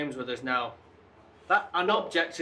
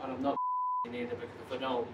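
withdraw quietly, needing to leave; upset; 2 semitones lower, 8 dB quieter, faster than usual. Real, not acted. Investigator says he must leave, upset and subdued. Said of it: "what's upset [name]".

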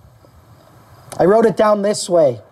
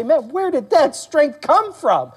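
high, slow astonished question; amazed; 6 semitones higher, slower than usual. Acted. Believer asking as the silence is broken, amazed. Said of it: "when the silence is broken and you say".

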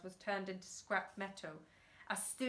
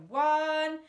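loud count; 6 semitones higher, 11 dB louder than usual. A narrator's reading.

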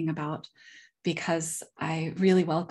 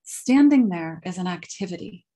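measured lecture reading, phrase openings punched louder; reading a prepared talk; 5 dB louder than usual. Real, not acted. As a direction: read it out formally, leaning hard into each new sentence. Reads a prepared paper.

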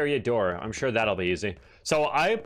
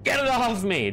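high panicked cry; 11 semitones higher than usual. Real, not acted. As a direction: yell in real panic. Creature attacking him.

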